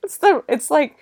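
cracking up mid-sentence; high, loud burst of laughter through the words; laughing. Real, not acted. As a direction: try to talk while laughing. Cracks up at the awkward line.

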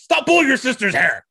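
high, loud scolding parent; dad voice. Shown as a demonstration. Friend shows off his dad voice, scolding loudly. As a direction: snap at a misbehaving kid. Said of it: "the perfect dad voice".

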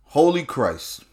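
normal talking voice

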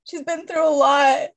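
high, teary voice, laughing through tears; crying while talking. Real, not acted. Speaking about her mom through tears. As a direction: push through the words while tears come. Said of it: "I feel like I'm crying".

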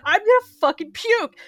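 high, loud panicked blurt; panicked puke line. Retold or imitated. Daughter answering her dad mid panic attack, loud and high. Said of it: "I'm like".

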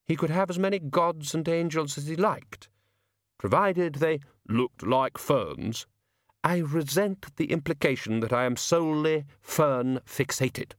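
high, slow, affronted artist; Jack Frost, resentful. A narrator's reading.

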